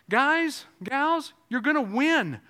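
high, loud, driving sermon voice; fiery preacher lifting the congregation; full-force preaching. Acted.

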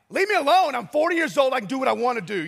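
high, loud mock protest to his son; mock outburst. Father yelling back at disapproving son, mock outburst. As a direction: whine it like a cornered dad.

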